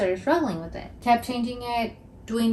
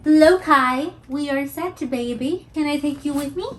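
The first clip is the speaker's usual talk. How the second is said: pet talk; 5 semitones higher, 6 dB louder than usual. Real, not acted. Owner talking to her dog Lokai, sing-song baby talk.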